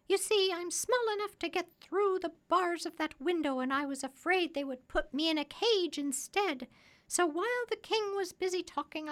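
high, loud parrot voice; high-pitched talking bird, pleased with the trick; clever parrot. A narrator's reading.

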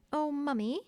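put-on high-pitched child's exclamation; crying out eagerly. A narrator's reading. First twin interrupting mother's story, crying out. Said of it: "cried the first twin".

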